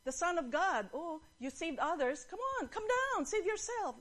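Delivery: high, mocking crowd jeers; retold jeering, pushed up high; insulting taunts. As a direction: taunt with rising pitch, goading. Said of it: "Insulto yun".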